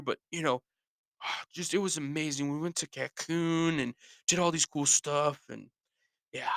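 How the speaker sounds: high strained voice, sighing between phrases; hammed-up long-suffering fan; pained and weary. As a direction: insist it's great while sounding in agony. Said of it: "look like they've been in pain".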